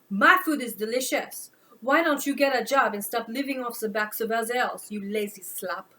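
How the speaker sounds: loud, indignant French woman; angry French accent. Acted. French woman scolding Hank for freeloading, loud.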